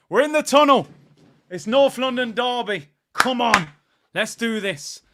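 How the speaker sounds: loud, high match-day hype; excited football fan shouting; hyped rallying shout. Real, not acted. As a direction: fire up the fans before kickoff. Hyping fans before derby kickoff.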